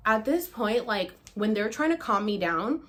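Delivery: normal talking voice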